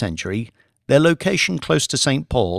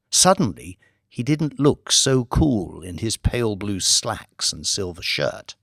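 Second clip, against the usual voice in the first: usual speaking voice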